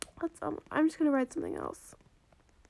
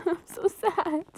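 a laugh sliding into a slow, half-joking cry; laugh, then whimper in fake misery; laughing mock sadness; 4 semitones higher, 4 dB louder, slower than usual. Real, not acted. Player whose trade was refused, laughing mock sadness.

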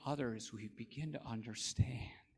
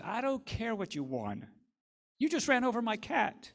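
rising higher and louder, indignant; angry retort; 9 semitones higher, 6 dB louder than usual. Acted. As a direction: scold him for running over the cat. Nancy angry about her cat.

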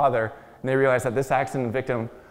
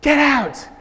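much higher, much louder shouted get out; screamed order; 11 semitones higher, 7 dB louder, much slower than usual. Retold or imitated. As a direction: bellow it like a crazed attacker. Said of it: "he's screaming".

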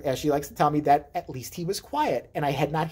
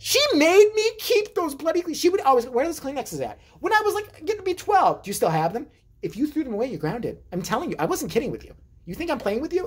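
higher mock-scolding mother; nagging mom; 10 semitones higher than usual. Mom nagging her son about the Kleenexes, mock-scolding. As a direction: nag like a mom checking up on you.

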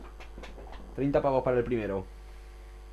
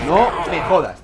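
angry outburst; 5 semitones higher, 9 dB louder, slower than usual. Real, not acted. Gamer losing the round.

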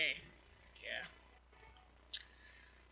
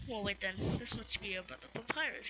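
ordinary delivery

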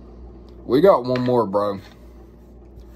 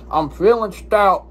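ordinary talk, nothing marked

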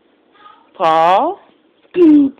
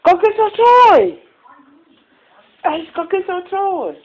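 excited urging; 7 semitones higher, faster than usual. Real, not acted. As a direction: rouse the puppy to play. Urging the dog.

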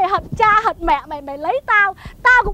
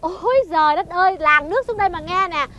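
usual speaking voice

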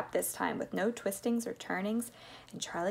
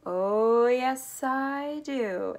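high, loud, drawn-out relish; gleeful confidence; 4 semitones higher, 5 dB louder, much slower than usual. A narrator's reading. Mister Wonka answering about the elevator, gleeful confidence. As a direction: draw it out with rising relish.

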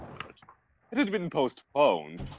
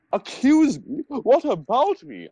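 high, loud hammed-up protest; indignant goth overacting; mock angry growl; 13 semitones higher, 5 dB louder than usual. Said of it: "I growled".

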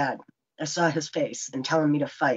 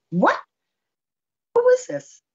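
very high, louder startled question; shocked surprise; 5 dB louder than usual. Retold or imitated. Woman answering an unexpected caller's cheeky question, shocked. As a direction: squawk it in retold disbelief.

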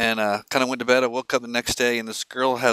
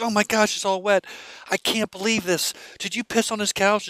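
put-on high, shocked girlfriend voice; upset girlfriend; 9 semitones higher than usual. Girlfriend discovering the wet couch, high and appalled.

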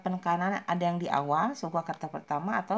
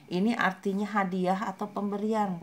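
ordinary delivery

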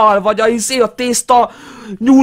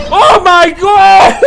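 drawn-out slow scream of fright; terrified shout; 6 semitones higher, 6 dB louder, much slower than usual. Real, not acted. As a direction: scream as it jumps out. Jump scare in horror game.

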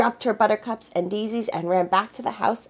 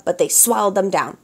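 ordinary delivery, nothing marked

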